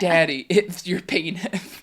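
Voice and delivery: put-on slow lisping toddler voice. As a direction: drag out the lisped words. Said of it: "at church hears".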